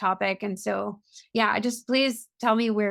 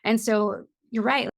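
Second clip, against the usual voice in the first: ordinary talk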